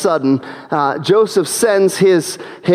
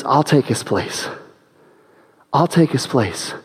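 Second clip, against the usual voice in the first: quiet sincere offer; 8 semitones lower, 5 dB quieter than usual. Acted. Judah offering himself.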